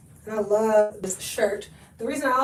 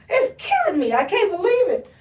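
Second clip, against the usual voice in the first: laughing; 7 semitones higher than usual. Real, not acted. Laughing about her sniffles.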